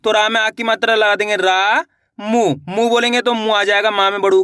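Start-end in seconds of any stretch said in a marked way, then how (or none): 1.4-1.8 "रा" held very long; slow, sustained "रा" for dictation; stretched vowel. Shown as a demonstration. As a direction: draw the vowel of "रा" right out. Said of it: "रा का उच्चारण खींच को रहा".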